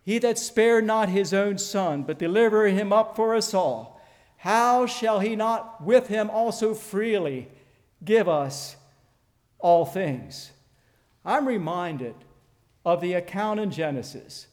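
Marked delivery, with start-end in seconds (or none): 0.0-10.5 full-force preaching. Acted. Preacher urging his congregation to remember, loud and fervent. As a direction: drive it home to the congregation.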